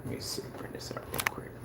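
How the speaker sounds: soft unvoiced whisper. Real, not acted. Whispering as he checks the channel. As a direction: breathe the words as you creep up on him. Sneaking a look into his channel.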